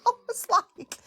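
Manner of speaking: high laughing mock-disappointment; laughing at the empty live. Real, not acted. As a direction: giggle out a fake sigh.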